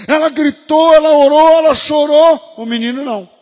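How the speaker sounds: loud, climactic sermon shouting; preaching at full force. Acted. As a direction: drive the point home, preaching at full volume. Pregação no auge. (pregação inflamada, gritando alto)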